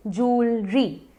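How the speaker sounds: जूलरी drawn out as "jool... ree"; slow correct pronunciation. Shown as a demonstration. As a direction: break जूलरी into two slow syllables. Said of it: "फिर से एक बार सुन लीजिए". (जूलरी को धीरे "जूल-री" बोलें)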